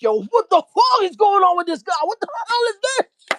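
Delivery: high, loud outraged shout; furious yell. Retold or imitated. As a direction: yell at her in rage. [name] confronting angrily.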